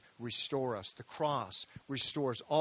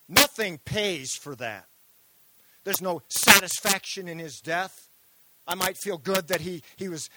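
high, loud, driving sermon voice; impassioned preaching; 8 semitones higher, 12 dB louder than usual. Real, not acted. Pastor urging the congregation, preaching at full force.

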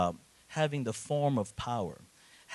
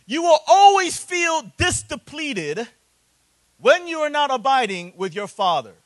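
loud, high, impassioned preaching; preaching at full force; 10 semitones higher, 11 dB louder than usual. Acted. Pastor preaching to the congregation about the prodigal son, fervent and loud. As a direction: drive the point home to the pews.

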